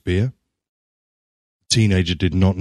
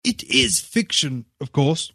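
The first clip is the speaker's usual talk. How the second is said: apologetic; 11 semitones higher, slower than usual. A narrator's reading. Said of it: "Wells said apologetically".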